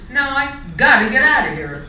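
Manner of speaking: butterfly snubbing the monkeys; loud exit. A narrator's reading. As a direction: shout it as you leave. Charlie snubs the mice.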